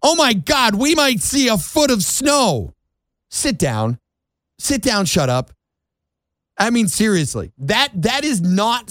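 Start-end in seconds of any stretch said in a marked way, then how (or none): 0.0-2.7 oh no, a foot of snow, mocking; mock panic. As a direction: ham up a frantic reporter. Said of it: "They're freaking out".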